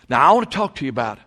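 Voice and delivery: usual speaking voice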